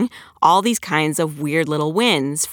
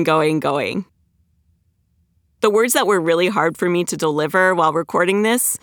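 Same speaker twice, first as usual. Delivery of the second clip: ordinary delivery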